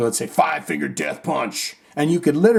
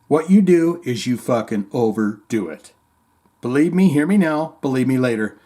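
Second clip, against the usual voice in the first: ordinary talk, nothing marked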